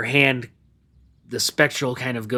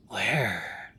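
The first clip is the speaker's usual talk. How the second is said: ghostly whisper; 7 dB quieter, much slower than usual. Acted. Banshee softens.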